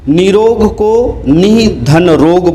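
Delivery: निरोग slowly, syllable by syllable; slow careful reading. Shown as a demonstration. Teacher to class, निरोग read slowly. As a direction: spell it out slowly for students. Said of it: "बोला जाएगा".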